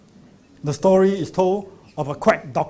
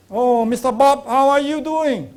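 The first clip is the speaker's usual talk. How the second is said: cheerful doctor greeting; 7 semitones higher, 5 dB louder than usual. Retold or imitated. Doctor greets patient in story.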